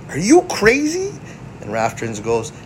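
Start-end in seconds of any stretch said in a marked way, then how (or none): 0.1-1.1 high mock Leo voice; quoting Leo, pitched way up; Leo's retort. Fellow turtle snapping back at Raph, mock high voice. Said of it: "Leo says".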